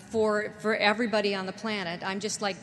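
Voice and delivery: ordinary talk, nothing marked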